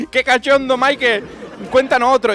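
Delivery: high laughing, cheering on the joker; laughing rats. Pretend. Rats cheering the scientist's jokes with laughter.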